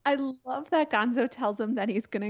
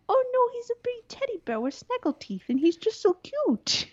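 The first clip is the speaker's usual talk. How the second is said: cooing; 12 semitones higher than usual. Retold or imitated. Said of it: "And I'm like".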